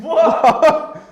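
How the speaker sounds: high, loud, laughing "what"; startled laugh breaking into the word; real amused surprise. Real, not acted. Startled by the episode count.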